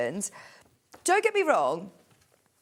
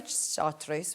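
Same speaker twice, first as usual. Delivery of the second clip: slightly Scottish chartreuse; Scottish accent; 6 semitones lower, 7 dB quieter than usual. Shown as a demonstration. Presenter admits a habit with chartreuse, slight Scottish accent. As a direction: slip into light Scots for the word. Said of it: "a slight Scottish accent".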